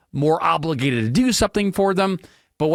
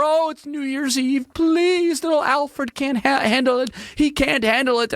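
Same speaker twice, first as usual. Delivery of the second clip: high, whiny, fussing over poor little Alfred; hammed-up pet parent whimpering much higher; mock whiny dog owner; 11 semitones higher than usual. Mocking a dog owner to listeners, high whiny voice.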